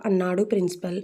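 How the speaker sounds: usual speaking voice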